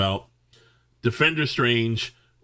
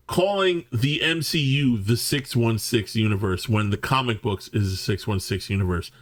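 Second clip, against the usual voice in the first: ordinary talk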